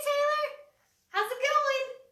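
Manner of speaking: bright, loud call to a friend; excited greeting. Real, not acted. Greeting a viewer who joined.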